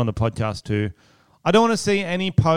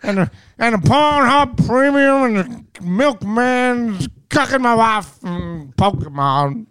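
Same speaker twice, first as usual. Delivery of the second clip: much higher, louder cartoonish Cosby rant; Trump doing Bill Cosby; 11 semitones higher, 5 dB louder than usual. Podcaster turns his Trump into Bill Cosby: high, loud, hammed-up. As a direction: go high and loud, hammy old man ranting. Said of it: "Donald Trump doing Bill Cosby".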